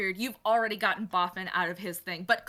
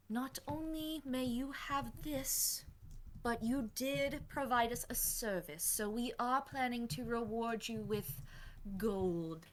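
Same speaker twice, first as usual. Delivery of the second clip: Morrigan's voice; 10 dB quieter than usual. Acted. NPC Morrigan offers a deal.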